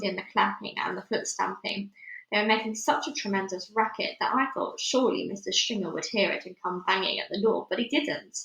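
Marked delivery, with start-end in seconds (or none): none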